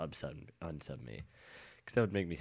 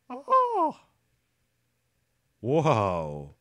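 sudden high startled cry; surprised shout; 10 semitones higher, 12 dB louder than usual. Real, not acted. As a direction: yelp in surprise at the view. Sudden view in game.

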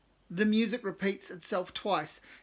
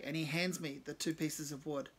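ordinary delivery, nothing marked

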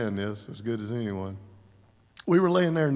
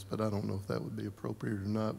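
normal talking voice

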